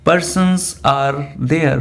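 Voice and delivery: "Three persons are there" drawn out very slowly, word by word; slow, deliberate classroom dictation; slow model sentence. Shown as a demonstration. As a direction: dictate it slowly for students to copy. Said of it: "तो आप क्या बोलेंगे?".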